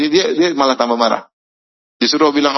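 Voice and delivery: ordinary delivery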